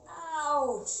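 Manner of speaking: loud high ouch of pain. Real, not acted. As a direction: yelp from a sharp stitch. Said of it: "this stitch to come".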